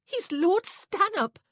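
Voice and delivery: very high, loud, slow panic; panicked interruption; frantic. A narrator's reading. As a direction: stress it frantically, pitched right up. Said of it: "Prudence frantically interrupted".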